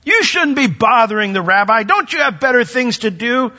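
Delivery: sharp rebuke. Retold or imitated. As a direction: shoo the parents away impatiently. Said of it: "express sharp disapproval or critique".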